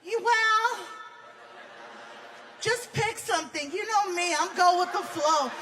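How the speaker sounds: high, soft girlfriend impression; indecisive girlfriend voice. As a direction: play the sweet, indecisive partner.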